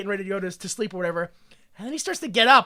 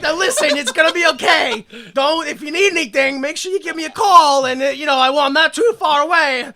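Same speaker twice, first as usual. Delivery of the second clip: high, loud mock parting speech; overwrought farewell; 7 semitones higher, 8 dB louder than usual. [name] parting from Hess forever, hammed-up and emotional. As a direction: rattle out a hammed-up last goodbye.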